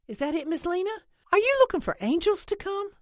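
high, loud, incredulous call. A narrator's reading. Holly calling to Lena from the picnic table. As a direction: call the question across the yard. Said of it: "Holly called out from her seat".

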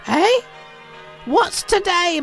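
high, eager question; excited high-pitched call. Acted. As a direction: call it down to someone below. Scrooge calls down to boy.

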